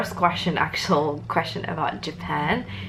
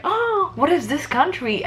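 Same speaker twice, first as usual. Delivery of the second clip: imitated puzzlement; 10 semitones higher than usual. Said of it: "but they're just like".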